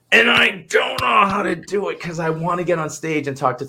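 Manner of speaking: high, loud frustrated shout; exasperated outburst. Retold or imitated. Speaker protesting being told to stop talking to crowds, exasperated shout. Airs frustration at repeated inner message.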